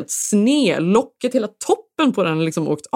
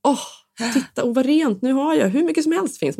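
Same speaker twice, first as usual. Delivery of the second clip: higher, thrilled little gush over clean laundry; delighted inner voice; 7 semitones higher than usual. Retold or imitated. Imagining the joy of clean laundry, higher and gushing. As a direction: gasp in mock delight at the clean pile. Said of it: "För man känner".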